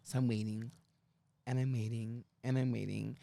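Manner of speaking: low, quiet, evenly repeated drone; tedious waiting. Retold or imitated. Driver waiting for passengers to close the door, low tedium. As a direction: draw out the wait in a low, soft monotone.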